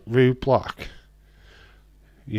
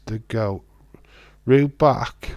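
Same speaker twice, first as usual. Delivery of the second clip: ordinary talk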